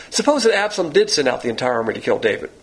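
usual speaking voice

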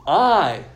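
I punched high and loud; stress on I. Shown as a demonstration. Preacher stressing "I" for the congregation. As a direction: hit "I" hard and high, then drop away. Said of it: "I said it very s-, very silently".

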